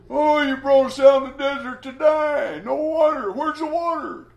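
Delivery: high, loud mocking whine; preacher mocking the people whining at Moses for water. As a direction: mimic the complaining crowd in a whiny wail. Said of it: "hearing their whining and their crying".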